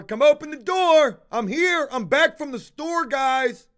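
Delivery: shouted call through the door; yelling. Retold or imitated. Man locked out, yelling for housemates to open up, loud. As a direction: yell up at the roommates to open the door. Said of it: "yelling some more".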